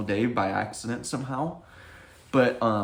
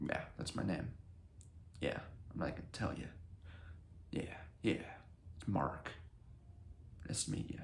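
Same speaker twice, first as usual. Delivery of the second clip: put-on whispery, confused old weatherman; Clueless Weatherman voice; 4 semitones lower, 15 dB quieter than usual. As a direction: breathe it out like a dazed old man. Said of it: "the Clueless Weatherman voice".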